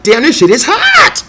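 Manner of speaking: high, slow, drawn-out "damn"; impressed exclaim. Retold or imitated. First hearing her mixtape, slow high impressed exclaim. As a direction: savor it, blown away.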